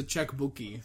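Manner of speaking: "checkbookie" with an ee tacked on the end; added E. Retold or imitated. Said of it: "He just adds the E at the end".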